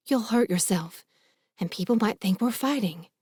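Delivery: ordinary delivery, nothing marked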